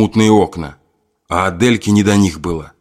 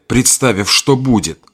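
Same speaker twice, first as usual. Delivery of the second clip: plain reading voice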